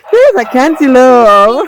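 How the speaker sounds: high, loud giggly delight; giggling. Real, not acted. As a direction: giggle through the words. Giggles after the scare.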